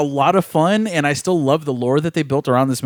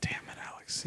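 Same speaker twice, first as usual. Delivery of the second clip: whispering; 21 dB quieter, faster than usual. Pretend. Mock sports-commentary disappointment whisper.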